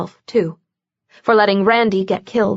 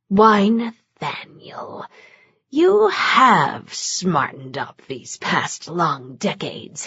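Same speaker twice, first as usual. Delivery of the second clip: slow, low, whispered menace; slow whispered demon menace; demon taunt; 4 semitones lower, 4 dB quieter, slower than usual. A narrator's reading. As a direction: savour every cruel word.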